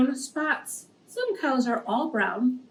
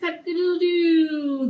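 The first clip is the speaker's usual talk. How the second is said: high crowing call, a little louder; rooster crow; 5 semitones higher, 4 dB louder than usual. Shown as a demonstration. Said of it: "Please say the songs and the s-, sounds along with the animals".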